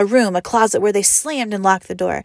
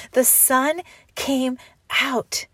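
slow, higher, awed; slow awe; 5 semitones higher, much slower than usual. A narrator's reading. Narrator in awe as the sun appears. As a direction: let the words open slowly with wonder.

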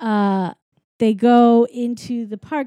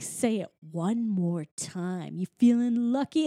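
quiet menace; 3 semitones lower, 7 dB quieter than usual. Retold or imitated. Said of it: "he's getting really mad".

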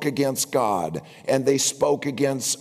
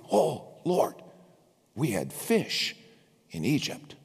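mock complaining; 6 dB quieter than usual. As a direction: sigh it like missing the old days. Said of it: "they reminded God".

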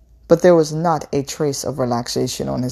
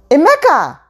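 high, loud yell; yelling his name; 13 semitones higher, 8 dB louder than usual. A narrator's reading. Mother yelling at her husband to stop, loud high shout. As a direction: shout to stop him cold. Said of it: "her mother yelled at him".

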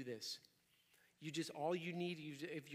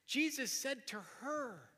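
"her" stretched out with raised pitch; stress on HER; 6 semitones higher, slower than usual. Shown as a demonstration. Preacher showing the memorizing trick, stressing "her". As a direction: land slowly on the word "her". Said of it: "Alternate emphasizing each word".

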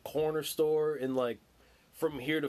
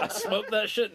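laughing mock confession; 5 semitones higher than usual. Laughing while quoting Spanky.

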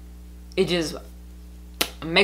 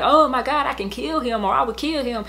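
higher-pitched, throwaway exaggeration in retelling; kid's casual exclamation; 7 semitones higher than usual. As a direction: imitate a kid exclaiming, pitched up. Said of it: "you know how kids will say it".

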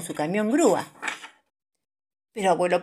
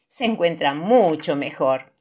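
plain reading voice

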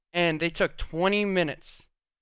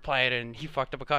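ordinary talk

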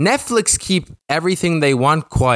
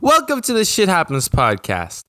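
higher, upbeat host welcome; bright host opener, restarting; show intro; 6 semitones higher than usual. Acted. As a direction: kick off the podcast like a host.

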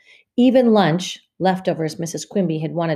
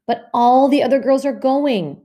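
put-on high and louder, a teen's protest; 8 semitones higher, 6 dB louder than usual. Beezus protesting to her mother about the sleepover. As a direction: plead against the unfair rule. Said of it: "protested Beezus".

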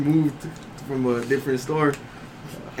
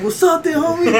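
high, loud squaring-up; confrontational challenge; 6 dB louder than usual. Retold or imitated. Cashier challenging a rude customer in a retold quarrel, high and confrontational. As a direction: call him out, pitched way up.

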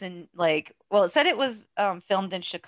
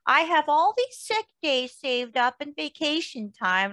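a slow, higher, lightly voiced proud mom; imitating mom; 8 semitones higher, slower than usual. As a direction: brag like a mom who never takes time off. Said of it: "she's just like".

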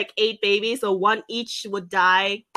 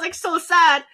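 ordinary talk, nothing marked

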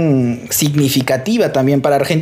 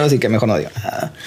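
usual speaking voice

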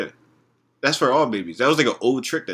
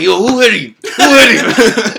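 mock shouting; 9 semitones higher, 8 dB louder, slower than usual. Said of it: "That bitch was like".

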